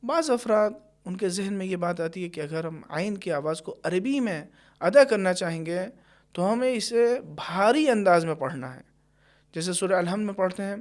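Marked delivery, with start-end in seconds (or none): none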